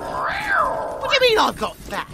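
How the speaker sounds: high, drawn-out outraged protest; indignant. Acted. Bard answering a jibe about weight, indignant. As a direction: squawk it in offended disbelief, stretched out.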